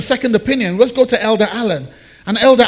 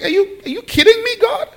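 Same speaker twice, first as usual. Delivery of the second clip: much higher, squeaky incredulous Abraham; mock disbelief; 13 semitones higher than usual. Abraham to God about a child at seventy-five, high mock disbelief. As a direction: shoot way up in pitch, as if he can't believe it.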